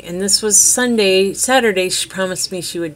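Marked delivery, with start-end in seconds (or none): none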